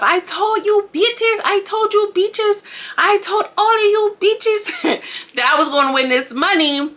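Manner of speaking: high, drawn-out mock crowing; hammed-up victory taunt, breaking into a laugh; triumphant gloating. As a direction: crow it slowly like a gloating winner. Acting out winner's triumphant gloating.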